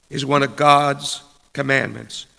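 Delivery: ordinary talk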